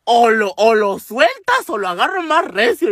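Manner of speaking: loud, slow-starting threat, rising high; mock-furious warning; threatening. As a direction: imitate an enraged woman giving an ultimatum. Angry woman threatens the neighbour.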